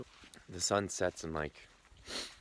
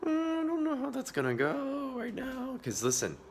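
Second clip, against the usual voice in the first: voice cracking; 13 dB louder, slower than usual. Shown as a demonstration. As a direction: voice gives out, nothing comes. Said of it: "'cause listen".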